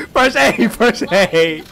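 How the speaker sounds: ordinary delivery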